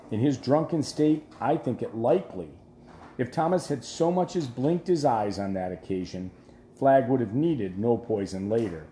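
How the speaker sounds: plain reading voice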